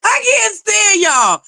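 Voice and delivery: high, slow mock outrage; mock exasperation. Teasing the chat with mock exasperation. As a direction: drag it out, pretending to be fed up.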